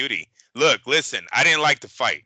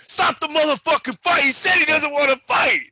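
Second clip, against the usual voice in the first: much higher, louder furious yell; livid shout; 4 dB louder than usual. Retold or imitated. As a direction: yell it at the ref, outraged. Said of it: "I'm fucking livid at this point".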